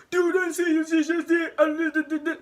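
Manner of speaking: mock him tripping over words; stammering classmate. Slammed boy sputtering back at narrator, mock stammer.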